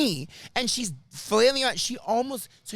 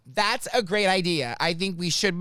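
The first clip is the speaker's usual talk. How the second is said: ordinary talk, nothing marked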